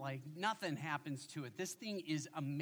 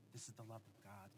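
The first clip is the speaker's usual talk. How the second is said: hushed reverence; 6 semitones lower, 11 dB quieter than usual. Real, not acted. Quote climax, hushed.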